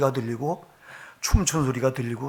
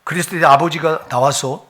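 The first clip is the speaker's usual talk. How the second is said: normal talking voice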